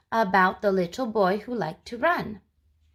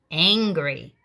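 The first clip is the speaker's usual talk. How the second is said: ordinary talk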